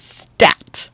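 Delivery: STAT slow and loud, with a long s; syllable for spelling. Shown as a demonstration. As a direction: sound out STAT slowly for writing. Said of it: "So let's write stat".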